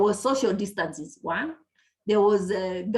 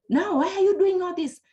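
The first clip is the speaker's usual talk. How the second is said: imitated angry shout; 9 semitones higher than usual. Impatient clinician scolding patients on the ward, shouting. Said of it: "you are shouting to patients".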